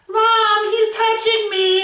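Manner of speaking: high, slow sing-song tattle; whiny tattling little sibling. Pretend. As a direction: whine it like a tattling kid. Mock sibling tattling to mom.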